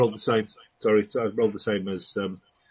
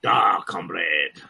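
mock Russian accent; 7 semitones lower, 4 dB louder, much slower than usual. Soviet background just rolled.

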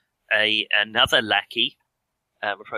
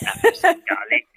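high, loud hammed-up French accent; French joke accent; 6 dB louder than usual. Player joking about garlic in a high, loud hammed-up French accent. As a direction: ham up the Frenchman. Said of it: "very French".

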